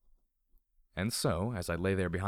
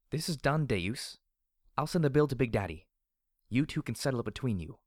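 higher-pitched, firm order; firm female boss; 5 semitones higher than usual. Acted. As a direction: lay down the law as the store's boss. Security boss stands off against Deus.

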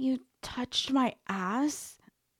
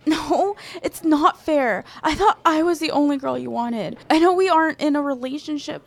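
loud, hurt and upset; 5 semitones higher, 9 dB louder, faster than usual. Acted. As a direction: raise your voice, wounded. Jealous confrontation after flirting.

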